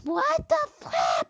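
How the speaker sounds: high, loud outburst; exasperated yell. Real, not acted. Player losing just short of nine, exasperated yell. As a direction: shout it in disbelief. Fails in the game.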